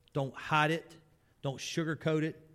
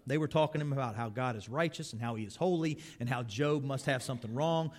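ordinary talk, nothing marked

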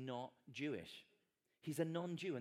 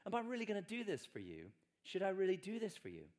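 sceptical surprise; 6 semitones higher, 3 dB louder than usual. Retold or imitated. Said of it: "exactly the same question".

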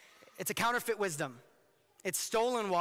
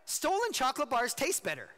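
ordinary delivery

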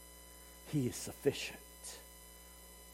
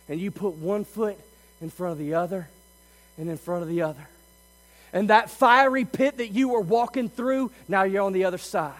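ordinary talk, nothing marked

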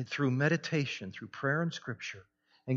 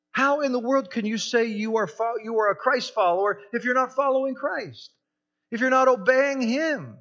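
loud, high impassioned preaching; preaching at full force; 8 semitones higher, 7 dB louder than usual. Real, not acted. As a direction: thunder it at the congregation. Preaching climax on sin.

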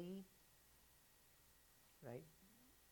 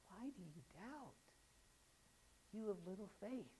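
gentle Jesus; 6 semitones higher, 6 dB quieter than usual. Acted. Jesus to the disciples, tender rebuke. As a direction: ask it kindly, not harshly.

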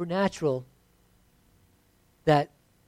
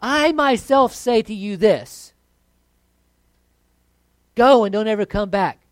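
emphatic command; 5 semitones higher, 10 dB louder than usual. Retold or imitated. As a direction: cast the demon out with full authority. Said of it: "more emphatic in Luke".

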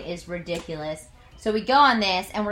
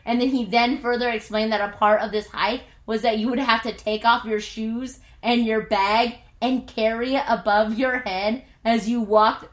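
ordinary talk, nothing marked

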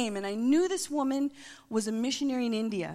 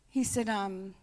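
ordinary talk

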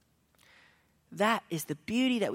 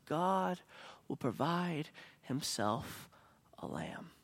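hushed, breathless whisper to his son; a father's soft whisper; 8 dB quieter, slower than usual. Acted. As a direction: whisper it like a secret promise. Abraham answers son quietly.

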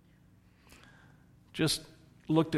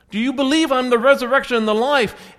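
urgent preaching; 6 semitones higher, 6 dB louder, faster than usual. Acted. Jesus pressing the sisters.

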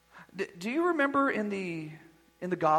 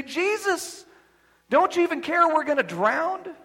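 panicked disciples; 8 semitones higher, 4 dB louder than usual. Retold or imitated.

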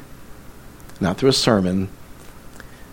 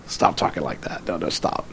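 hushed, breathless whisper of disbelief; whispered plea; 7 semitones lower, 9 dB quieter than usual. Retold or imitated. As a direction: whisper it, begging her to stop. Pleading with dying friend.

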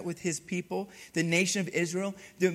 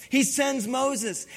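normal talking voice

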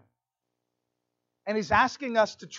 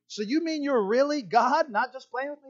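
higher-pitched, incredulous checking; mock amazement; 6 semitones higher than usual. Jack asking if it is really God, amazed. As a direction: make sure it's no joke, astonished.

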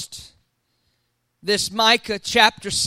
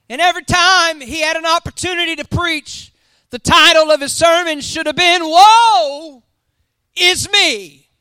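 loud, high, rising sermon delivery; full-force preaching; 6 semitones higher, 8 dB louder than usual. Acted. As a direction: preach it big to the congregation.

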